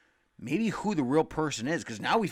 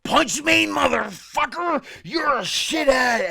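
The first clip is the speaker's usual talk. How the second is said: much higher, louder mock yell of a brawler begging for a fight; hammed-up rage: "Punch me, motherfucker!"; retold furious yell; 12 semitones higher, 9 dB louder, slower than usual. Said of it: "And he's like".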